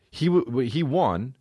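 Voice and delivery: ordinary talk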